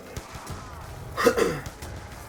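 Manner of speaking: a real wet cough, no words. Real, not acted. Sick vlogger coughing into the mic, real cough. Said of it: "I know I'm coughing".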